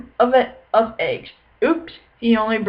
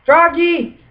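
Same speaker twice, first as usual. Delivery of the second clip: mother calls Froggy, higher; calling out; 7 semitones higher, 3 dB louder than usual. A narrator's reading. Mother calls Froggy from another room, raised call. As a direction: call his name across the house. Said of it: "called his mother".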